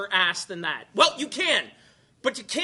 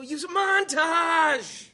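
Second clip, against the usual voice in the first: high, slow shouted punchline; 8 semitones higher, slower than usual. Acted. Host selling the montage joke, slow shout. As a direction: belt it out like a motto.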